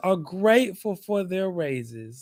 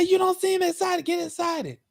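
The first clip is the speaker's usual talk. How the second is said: much higher pleading boss voice; imitating manager's high voice; 12 semitones higher than usual. Mocking his manager urging him to get excited, very high voice. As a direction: squeak it out like the pushy manager. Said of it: "She said".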